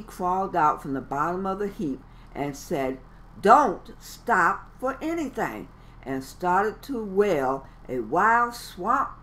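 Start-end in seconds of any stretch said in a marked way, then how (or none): 3.4-5.7 determined shout. Acted. Froggy repeats the order.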